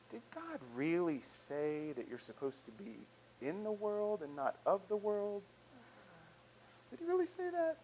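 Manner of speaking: insinuating doubt. Acted. Culture's voice questioning God's word, softly doubting. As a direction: plant a quiet, incredulous doubt. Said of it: "that voice of culture sometimes that says".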